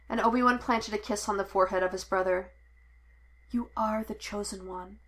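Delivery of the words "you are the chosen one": soft, hushed blessing; quiet and tender; whispered. A narrator's reading. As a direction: breathe it against his forehead. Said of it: "he whispered against Anakin's skin".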